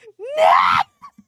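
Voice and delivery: loud, high-pitched cry; exasperated wail. Real, not acted. Viewer won over by sideburn guy, loud helpless wail. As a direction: let out a high, loud squawk.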